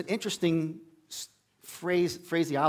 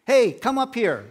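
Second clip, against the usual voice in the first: calling taunt; 8 semitones higher, 7 dB louder, slower than usual. Retold or imitated. Enemy soldiers calling down from hill.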